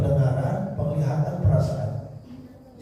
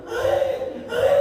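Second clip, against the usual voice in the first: very high, laughing baby cry, mock wail; preacher imitating a hungry baby crying, squealing high; crying baby imitation; much slower than usual. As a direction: play a newborn fussing for milk with a laugh. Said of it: "Ngomongnya deh".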